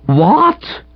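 sharp rising yowled What. A narrator's reading. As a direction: yelp the word out in shock. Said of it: "in a sort of yowl".

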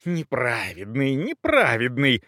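slow, seething repeat of the word; drawn-out indignant fury, not shouted; furious repetition. A narrator's reading. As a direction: chew on the insult in restrained rage. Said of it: "повторяла Брунхильда в ярости".

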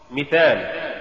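ordinary delivery

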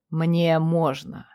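low, slow, self-satisfied; smug low drawl. A narrator's reading. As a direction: say it slowly, smug and sure. Райер answers, slow and low. (ленивый самодовольный тон)

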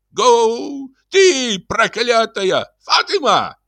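very high, strained yells; drawn-out high-pitched shouts; cursing and calling out. A narrator's reading. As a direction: drive the horse on and holler for help. Driving horse, calling for help.

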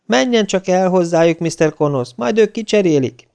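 high, placating voice; soothing. A narrator's reading. Security office sending the guard to the supplier, soothingly. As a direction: reassure an irritated caller. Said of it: "felelte Amasz csillapítóan".

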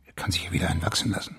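fast whispered aside; quick whisper. Acted. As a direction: toss it off quickly under your breath. Flüstern neben dem Patienten. (schnell geflüstert)

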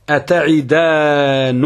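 slow, drawn-out "أتعدانني" with -nunī; slow recitation of "أتعدانني", u after the first nun; first nun with damma. Shown as a demonstration. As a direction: stretch "أتعدانني" as ata'idaa-nu-nī. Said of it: "بضم النون الأولى".

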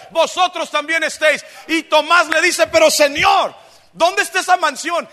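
loud, high pulpit proclamation; emphatic preaching. Real, not acted. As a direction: drive each phrase home to the congregation. Preaches at full force.

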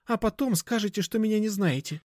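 put-on high-pitched girl's voice, suspicious; distrustful girl customer. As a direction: push back warily in a high voice.

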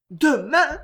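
high, loud, appalled echo; aghast. A narrator's reading. Jeanne hearing the wedding is tomorrow, aghast. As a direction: repeat it aghast, voice jumping up. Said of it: "répéta Jeanne atterrée".

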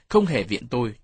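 ordinary delivery, nothing marked

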